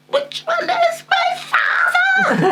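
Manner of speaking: high, slow, wailing mock cry of a confused child; mock panicked cry. Child crying out at two identical fathers, mock wail. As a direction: wail it like a lost little kid.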